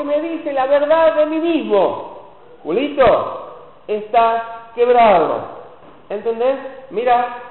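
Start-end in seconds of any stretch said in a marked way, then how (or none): 2.6-5.6 loud, slow, stretched out "quebrado"; slow blunt verdict. Retold or imitated. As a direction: spell out the bad news slowly and firmly. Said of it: "La placa lo que hace es me acusa".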